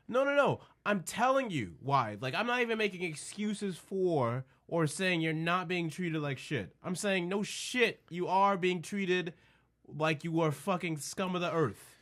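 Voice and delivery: high-pitched, emphatic retort; exasperated insistence. Retold or imitated. Friend answers the complainer, emphatic and exasperated. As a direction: drive it home, pitched well up.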